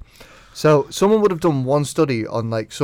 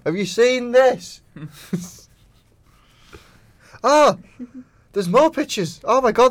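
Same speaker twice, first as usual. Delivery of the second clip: slow, higher, excited gossip; 8 semitones higher, 4 dB louder, slower than usual. Retold or imitated. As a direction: gasp it out like fresh gossip. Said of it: "go through Twitter and go".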